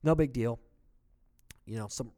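normal talking voice

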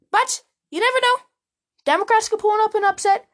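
high, squeaky mock-hopeful lilt; teasing maybe. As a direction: pitch it way up, as if dangling a faint hope. Joking about an unlikely upset.

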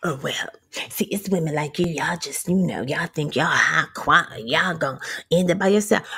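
low, hushed sneering man; bitter date. Retold or imitated. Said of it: "And this man".

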